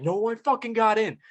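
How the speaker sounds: high-pitched mock shout of protest; retold yell. Arguing with the other player over the touchdown, high mock yell. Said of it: "screaming at each other".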